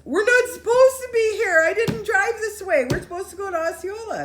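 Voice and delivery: very high, very loud alarmed cry; frantic high protest; panicked. Retold or imitated. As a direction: shriek in disbelief at where they are. Friend panicking at wrong place.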